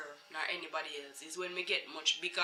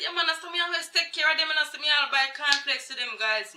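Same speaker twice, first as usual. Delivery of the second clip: higher, fast, louder self-praising boast; lady bragging she takes care of them; boastful lady; 8 semitones higher, 5 dB louder than usual. Retold or imitated. Lady bragging to the mother, high and fast.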